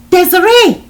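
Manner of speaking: loud, high-pitched desperate shout; desperate holler. A narrator's reading. John calling Desiree over the phone, desperate holler. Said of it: "John hollered in a desperate voice".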